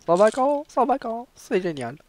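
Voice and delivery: high, loud cheer; excited cheer. Real, not acted. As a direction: squeal with joy about the holidays. Cheering about holidays.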